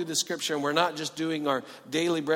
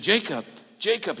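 God calling a name, raised and higher; 6 semitones higher, 6 dB louder than usual. Acted. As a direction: call across the night, loud and high. God calling Jacob in vision.

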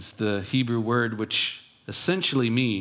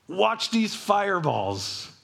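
showboating God; 9 semitones higher than usual. Pretend. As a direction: boom it out like a God showing off his miracles. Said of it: "I would be such a showboat".

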